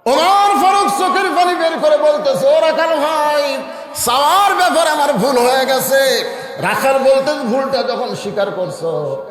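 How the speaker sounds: high, loud, impassioned chant; full-force preaching. Acted. Omar confesses his mistake to the shepherd, high fervent preaching. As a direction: cry the story out to the whole gathering.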